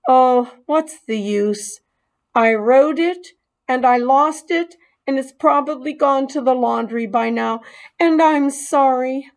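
resigned apology; mild sorry mouse. Acted. Mouse apologizing to the boy, a touch despairing.

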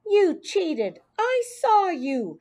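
put-on high, heated child's yell; high-pitched angry outburst; yelled accusation. A narrator's reading. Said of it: "she yelled".

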